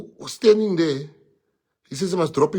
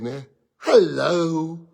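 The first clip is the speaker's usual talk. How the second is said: usual speaking voice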